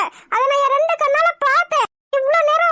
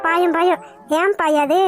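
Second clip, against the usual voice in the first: low-pitched, dry retort; sarcastic retort; 7 semitones lower, slower than usual. Acted. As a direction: answer the flirting in a low undertone.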